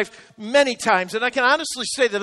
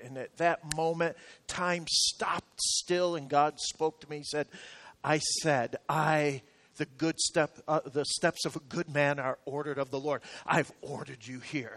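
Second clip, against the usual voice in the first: hushed awe; 7 semitones lower, 11 dB quieter than usual. Real, not acted. God speaking moment, hushed retelling.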